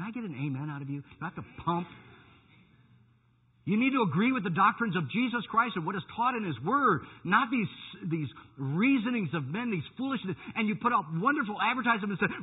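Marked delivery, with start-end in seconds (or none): 3.6-12.4 high, loud pulpit exhortation; forceful preaching. Real, not acted. Preacher rebuking false doctrines to his congregation, forceful preaching. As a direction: press it on the congregation with rising force.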